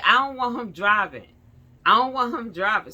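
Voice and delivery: loud, high, emphatic refusal; imitated insistence. Officer forbids the husband driving, imitated insistence. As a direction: lay down the rule loudly.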